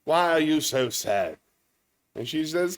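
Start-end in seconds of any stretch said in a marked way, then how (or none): 0.1-1.4 hammed-up old English gent, louder and higher; drawn-out, over-sympathetic Englishman's question. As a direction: play a theatrical old Englishman. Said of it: "An old Englishman's walking down the beach".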